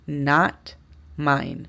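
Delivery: "not mine" stretched out very slowly, "not" said "nat" and "mine" said "main"; slow careful demo. Shown as a demonstration. Teacher modeling the negative for Spanish speakers, very slow. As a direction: draw out "not mine" for the learners. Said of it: "Como pueden imaginar, es".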